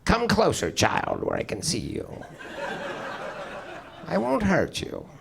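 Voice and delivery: high, slow creepy mother voice; intimidating mother. Retold or imitated. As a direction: lure the child like a menacing mum. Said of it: "My mom was very intimidating".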